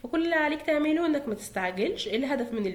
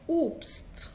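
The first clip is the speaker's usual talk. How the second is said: playful quiz whisper; 3 semitones higher, 4 dB quieter, slower than usual. Acted. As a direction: whisper the next word like a secret. Quiz teacher whispering the word. (همس مرح وهي تعرض الكلمة)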